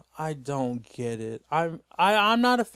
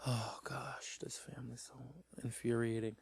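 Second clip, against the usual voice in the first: much softer whisper, fed up; exasperated whisper; 4 semitones lower, 15 dB quieter than usual. Real, not acted. Griping about the family, a soft whispered groan. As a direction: breathe it out, done with them. Fed-up aside, unvoiced far quieter.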